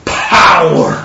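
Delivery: very slow, stretched-out scripture reading; emphatic proclamation. Acted. Preacher quoting the promise, slow emphatic proclamation. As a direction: draw out each word up to a big POWER.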